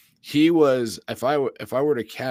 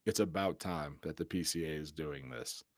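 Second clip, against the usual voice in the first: hushed mentor voice; 6 semitones lower, 11 dB quieter than usual. Retold or imitated. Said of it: "He goes".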